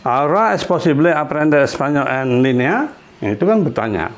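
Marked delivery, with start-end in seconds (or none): 0.0-2.8 "línea" lifted clearly at the end; teacher showing the rise on "línea"; clear question intonation. Shown as a demonstration. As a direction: make the question tune obvious. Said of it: "harus jelas intonasi tanyanya".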